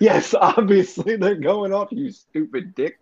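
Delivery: slow, emphatic mock answer; sarcastic exasperation. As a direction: spell it out slowly for an idiot. Imagined answer to silly police call.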